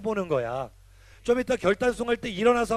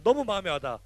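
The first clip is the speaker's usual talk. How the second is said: normal talking voice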